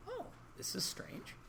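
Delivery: breathy whisper to himself; hushed, wary inner whisper; whispered thought. Retold or imitated. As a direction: whisper it like a private aside.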